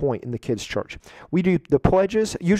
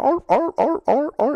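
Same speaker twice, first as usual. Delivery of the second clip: high barking seal noises; seal barks; 12 semitones higher than usual. Retold or imitated. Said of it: "clapping like a seal".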